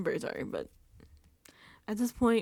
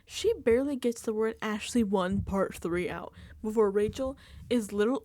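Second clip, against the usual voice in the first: usual speaking voice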